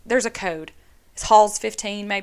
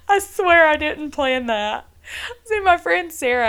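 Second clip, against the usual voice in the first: laughing protest; 6 semitones higher, 5 dB louder than usual. Real, not acted. Laughing protest after Sarah mention.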